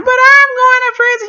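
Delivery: loud, high-pitched wail; reenacted panicked objection through tears; tearful protest. Acted tearful protest to friend.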